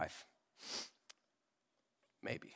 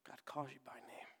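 hushed, breathy near-whisper, softer; 15 dB quieter than usual. Acted. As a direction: lean in and whisper it like a secret. Intimate moment of God calling.

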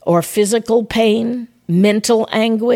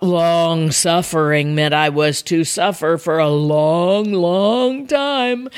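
low start, climbing on the last drawn-out "long"; mock endless suffering; 3 semitones lower, slower than usual. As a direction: stretch out each "long" wearily. Jokes about misreading long suffering.

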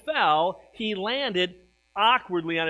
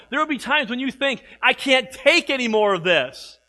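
louder proclaiming preacher voice; full-force preaching; 5 semitones higher, 6 dB louder than usual. Acted. Pastor warning believers about trouble, full-force preaching. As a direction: declare it to the whole church.

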